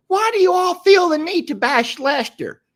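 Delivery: high, loud, sugary Southern Belle take on a viewer comment. Pretend. Reading a viewer's comment aloud as a Southern Belle. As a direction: play the offended Southern lady defending Lester. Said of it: "in my Southern Belle voice".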